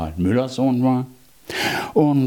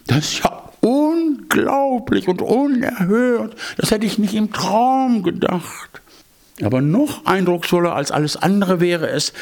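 mock astonished cat; 9 semitones higher than usual. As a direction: gush like a scheming cat pretending awe.

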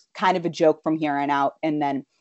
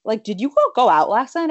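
imitated motherly questioning, higher pitched; lightly voiced mother; 6 semitones higher than usual. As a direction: lightly play a mother asking, ending on a rise. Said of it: "She's like, um".

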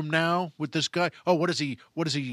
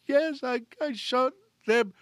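high mock-whimpering confession; acted tearful maid; crying; 8 semitones higher than usual. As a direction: squeeze it out through pretend tears. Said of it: "she's whimpering, she's crying".

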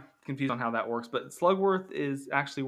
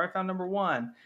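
put-on excited kid; 7 semitones higher than usual. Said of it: "all the kids who won".

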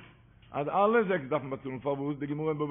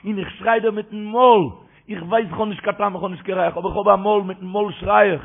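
loud, higher-pitched plea to God; crying out; 3 semitones higher, 10 dB louder, much faster than usual. Acted. Worshipper with no merits crying to God, loud impassioned shout. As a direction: cry it out with full force, imploring. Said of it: "דער מיר שרייט".

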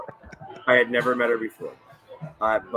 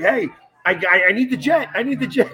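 high, louder retold plea; eager calling out; 10 semitones higher, 5 dB louder than usual. Retelling pestering the boss walking off stage, eager calling out. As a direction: call after someone walking away, pitch jumping up.

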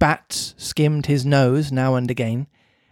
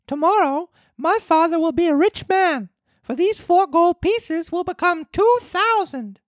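put-on high puppet boy voice; Pinocchio voice. Pinocchio answers.

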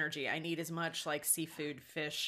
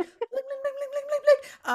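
much higher, playful singsong chant; singsong bling; 7 dB louder than usual. Retold or imitated. Server sprinkling gold on ice cream, high singsong. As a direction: chant it up high like a sparkle sound. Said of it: "she's like".

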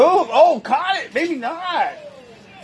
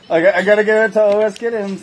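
ordinary talk, nothing marked

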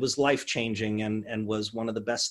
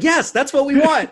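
eager producers; 13 semitones higher, 11 dB louder than usual. Retold or imitated. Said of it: "And they're like".

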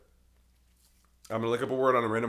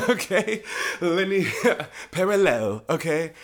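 laughing; 5 semitones higher, 4 dB louder, slower than usual. Real, not acted. Laughing at own joke.

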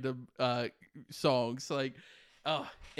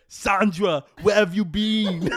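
Arnold in a soap opera; 3 semitones higher, 6 dB louder than usual. Acted. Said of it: "Arnold Schwarzenegger playing all of the parts".

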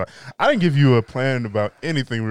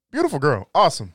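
louder and higher, gushing praise; 5 semitones higher, 6 dB louder than usual. Real, not acted. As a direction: rave about her.

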